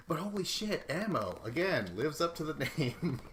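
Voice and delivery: real amused excitement; excited laughing. Real, not acted. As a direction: exclaim it with a laugh.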